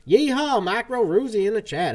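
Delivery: loud, high cowboy holler; yeehaw greeting. Real, not acted. Streamer hollers a cowboy welcome at new viewer, loud and high. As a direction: whoop hello at the viewer.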